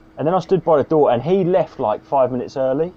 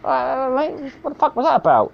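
baffled MC; 10 semitones higher than usual. Retold or imitated. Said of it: "I went".

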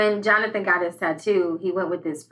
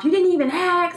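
mock mom scolding; 8 semitones higher than usual. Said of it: "goes off".

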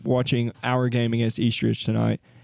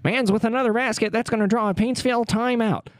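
put-on higher old-time radio caller; 1940s radio announcer; 8 semitones higher than usual. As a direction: call the game like 1940s radio. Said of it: "talk like that during play-by-play".

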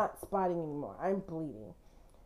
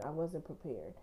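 trailing off, much softer; 4 semitones lower, 10 dB quieter than usual. Real, not acted. Mother recalling a miscarriage, quietly fading repetition. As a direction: let prepared fade almost to nothing.

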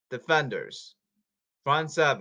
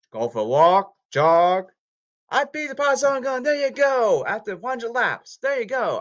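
loud, high hype-man cheering; hyped cheering; 8 semitones higher, 7 dB louder than usual. Real, not acted. Cheering himself on about jogging, loud and excited. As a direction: pump yourself up, shout it.

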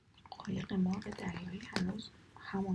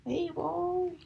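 sharp, much higher and louder cry of pain; yelp of pain; 10 semitones higher, 8 dB louder, much slower than usual. Acted. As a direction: shriek as the thorn is yanked. Thorn yanked hard from foot.

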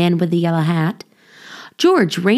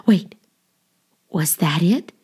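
usual speaking voice

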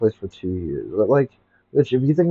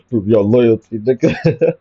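laughing; 4 semitones higher, 8 dB louder, slower than usual. Real, not acted. Laughing through the catchphrase.

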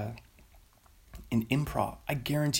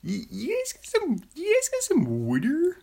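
high, loud mock Philly accent; Philadelphia accent; 3 dB louder than usual. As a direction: ask for a drink like a brash Philly local. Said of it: "how you ask for water in Philadelphia".

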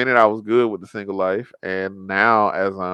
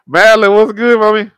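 loud, high hype call-out; loud greeting; 10 semitones higher, 9 dB louder than usual. Real, not acted. Hyping up a viewer joining the live. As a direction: call her out loud and bright.

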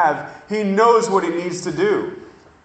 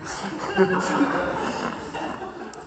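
laugh; 3 semitones higher, 5 dB quieter than usual. Real, not acted. Laughing at own joke.

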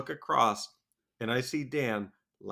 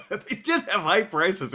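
laughing; 10 semitones higher, 6 dB louder than usual. Real, not acted. Laughing at the high prices.

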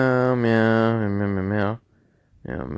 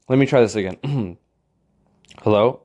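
ordinary delivery

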